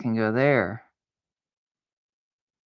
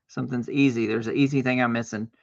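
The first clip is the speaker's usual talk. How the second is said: normal talking voice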